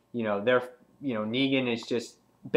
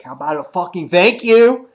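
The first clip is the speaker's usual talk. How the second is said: loud, high mock outrage; raised, punchy retort; acted indignant demand; 6 semitones higher, 13 dB louder than usual. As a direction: demand gratitude like an offended villain. Negan demanding thanks from Rick.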